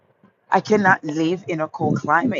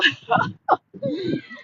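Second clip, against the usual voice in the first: high laugh. Real, not acted. Laughing at cold-weather viewers.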